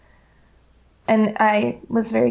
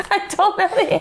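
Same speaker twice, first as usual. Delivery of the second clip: laughing admission; 9 semitones higher than usual. Real, not acted. Caught out, laughing.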